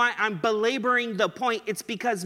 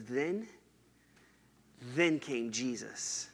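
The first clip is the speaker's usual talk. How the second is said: hushed reverent weight on Then, then came Jesus; quiet awed emphasis; emphasis on every word; 8 semitones lower, 10 dB quieter, slower than usual. Shown as a demonstration. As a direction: lower and much softer, slow, Then repeated. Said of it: "Matthew didn't want us to lose sight".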